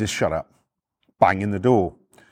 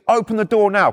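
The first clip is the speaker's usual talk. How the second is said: higher, forceful mock command; ballistic police order; 5 semitones higher, 3 dB louder than usual. Copper ordering neighbour to open up, ballistic. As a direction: demand it urgently, voice raised. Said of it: "This copper went ballistic".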